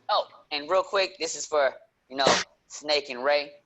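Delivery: ordinary talk, nothing marked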